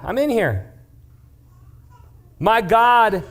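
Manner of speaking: high, casual, cheerful reply; cheerful Daniel. Acted. Daniel calling up from the den, high and cheery. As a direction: toss it up like it's nothing. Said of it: "And Daniel goes".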